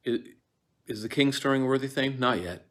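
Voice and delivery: fast, Not yet follows with no pause; quick unbroken line, no laugh pause; run straight on. Shown as a demonstration. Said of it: "Don't go for a laugh".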